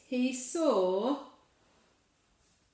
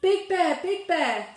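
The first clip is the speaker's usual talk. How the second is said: high, loud frightened squeal; 7 semitones higher, 6 dB louder than usual. Acted. Little Bear squealing for Big Bear, high and loud. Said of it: "squealed Little Bear".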